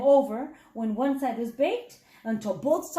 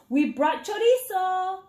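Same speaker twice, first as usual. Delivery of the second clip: calling out; 5 semitones higher, 3 dB louder than usual. A narrator's reading. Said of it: "Mommy Mouse called".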